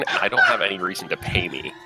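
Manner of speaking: ordinary talk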